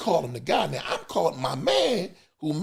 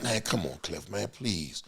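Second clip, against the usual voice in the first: mock pleading; 6 semitones lower, 9 dB quieter than usual. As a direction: beg quietly like a kid. Friend begging for dessert.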